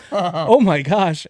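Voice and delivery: slow, drawn-out, high mock shock; amazed at the passed-out woman, slow and loud; slow hammed-up disbelief; stunned. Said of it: "I'm like".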